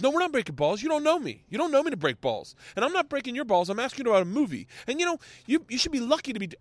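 high, indignant mock rant; Ronnie's tirade. Radio host cutting the guest down, high-pitched outrage. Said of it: "Ronnie breaks him fucking off".

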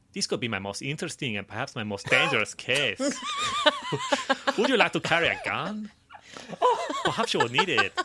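put-on detective voice with a French accent. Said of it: "you've gone French as well".

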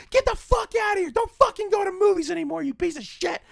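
high shouted rage; high mock shout at teenagers; mock angry yell. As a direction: scream it at the kids. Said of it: "him and his little friends, like".

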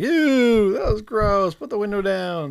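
high, loud disgusted squeal. Retold or imitated. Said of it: "everyone's like".

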